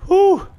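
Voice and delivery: sharp, high, loud whew of relief; huge relieved exhale; big relief whew. Real, not acted. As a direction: blow out the fear in one high burst. Relief after the scare passes.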